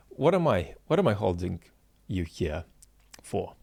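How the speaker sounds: ordinary talk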